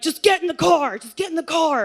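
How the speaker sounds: high, firm repeated order; imitating an angry dad; stern dad. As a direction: snap it like a fed-up father. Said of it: "my dad's like".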